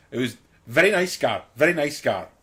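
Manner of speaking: retold line in a thick Indian accent; imitated Indian accent. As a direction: put on an Indian accent, loud and emphatic. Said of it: "with quite a thick Indian accent".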